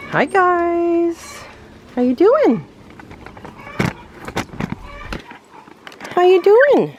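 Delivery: high sing-song pet voice. Real, not acted. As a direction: coo at the animals. Greeting animals in pet voice.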